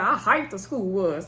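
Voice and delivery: ordinary delivery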